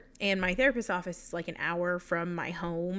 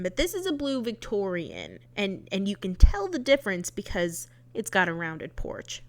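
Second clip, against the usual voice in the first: ordinary talk, nothing marked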